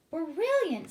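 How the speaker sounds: high, loud delighted exclamation; queen thrilled by the plan; brilliant, bursting with delight. A narrator's reading.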